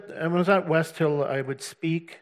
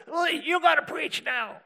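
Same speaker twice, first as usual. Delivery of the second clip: much higher, slow, shouted insistence; mock urgent call; 13 semitones higher than usual. Tom telling the speaker to preach, high shout. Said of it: "And he says".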